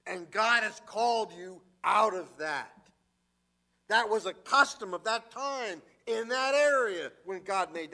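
rising fervent preacher's voice; preaching at full force. Acted. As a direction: drive each point home to the congregation.

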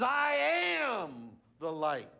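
"I am the light" shouted slowly with weight on "am"; emphatic shout. Shown as a demonstration. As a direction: proclaim it slowly at full voice. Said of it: "Not a light".

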